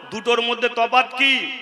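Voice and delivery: normal talking voice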